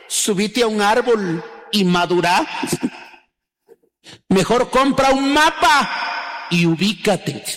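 mock the stuck-up fresa girl; hammed-up snob girl putdown; posh girl talk. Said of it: "muchachas que habla raro".

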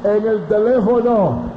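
high, loud, slow impassioned preaching; high-pitched, loud, slow fervent testimony; preaching at full force. Acted. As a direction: lift the congregation with fervour.

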